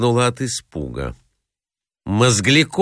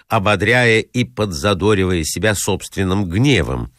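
plain reading voice

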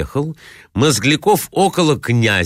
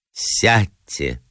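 sad; 3 semitones lower than usual. Acted. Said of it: "грустно проговорила она".

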